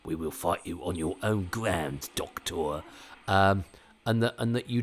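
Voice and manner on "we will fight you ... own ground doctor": low, joking Cockney warrior; Cockney monster impression. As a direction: put on a mock Cockney alien voice. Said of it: "had been Cockney, you know".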